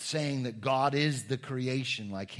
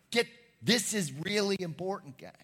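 urgent emphasis; 6 semitones higher, 4 dB louder than usual. Retold or imitated. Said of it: "he's like".

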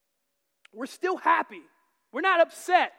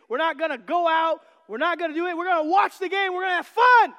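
building from lively to a loud shout; pumped game-day fan, ending in a shout; hyped-up fan; 4 semitones higher, 6 dB louder than usual. Retold or imitated. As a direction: whip yourself up like a fan before kickoff. Football fans hyped for the game.

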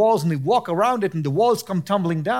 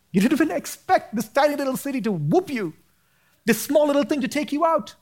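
sudden high-pitched preacher's exclamation; impassioned preaching; 9 semitones higher than usual. Real, not acted. Preaches at a peak.

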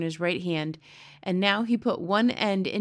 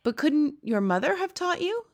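put-on higher girlish question; a curious child asking the giant; little girl voice; 8 semitones higher than usual.

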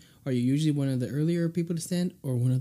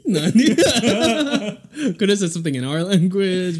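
laughing; 7 semitones higher, 9 dB louder than usual. Real, not acted. Laughs at the nani answer.

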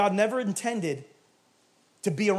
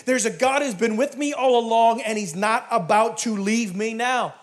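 loud, high preacher's shout; full-force preaching; 5 semitones higher, 7 dB louder than usual. Acted. Preacher banishing lack from the table, full-force preaching. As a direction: build to a ringing, defiant declaration.

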